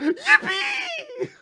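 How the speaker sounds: squealing very high cheer; very high squeal of joy; overjoyed Denji. Retold or imitated. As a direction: shriek it like a thrilled kid. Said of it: "He's like".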